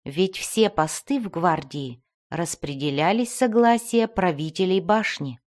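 usual speaking voice

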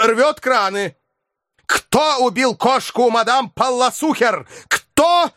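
high, heated, indignant scolding; furious tirade. A narrator's reading. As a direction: rail at him for the damage.

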